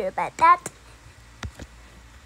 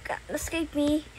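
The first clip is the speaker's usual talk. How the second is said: ordinary talk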